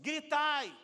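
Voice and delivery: high, loud battle cry; shouted command. Acted. Joshua ordering the people to shout, shouted command. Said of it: "o muro caiu pelo grito".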